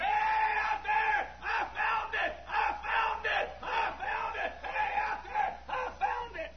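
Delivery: very high, far-carrying holler; hollered out the window. Retold or imitated. Preacher hollering out the window to passing drivers. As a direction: call out to the freeway. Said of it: "I hollered out the window".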